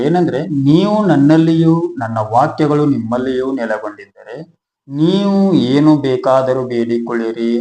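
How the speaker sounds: loud, ringing sermon proclamation; preaching at full force. Acted. Preacher proclaiming a Bible promise to the congregation, loud and ringing. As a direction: proclaim the verse to the whole church.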